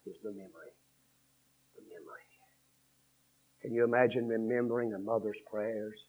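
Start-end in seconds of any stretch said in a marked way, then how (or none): 1.7-2.3 very soft, low whisper; hushed echo. Real, not acted. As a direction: let it sink to a whisper. Preacher echoes the phrase for effect.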